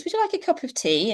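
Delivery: cheery offer. Shown as a demonstration. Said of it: "offer a physical tea and biscuits".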